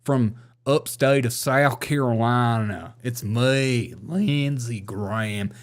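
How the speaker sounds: distinguished Southern gentleman, slow drawl; hammed-up Southern senator, slowing to the end; Lindsey Graham impression. Shown as a demonstration. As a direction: drag out the vowels like a genteel South Carolinian. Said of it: "you have to talk like a".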